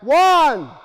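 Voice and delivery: sharp, high, loud shout of the number; yelled count. Shown as a demonstration. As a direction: show them how to yell it. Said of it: "you yell out".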